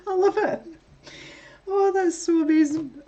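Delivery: high delighted gush. Real, not acted. Reading a chat message, delighted high gush.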